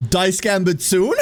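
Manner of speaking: slow gleeful shout of the game's name; excited shout. Real, not acted. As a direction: shout it out thrilled. Excited shout about news.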